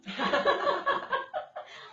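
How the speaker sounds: bright high burst of laughter. Real, not acted. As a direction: laugh out loud, pitched high. Laughing at her daughter's story.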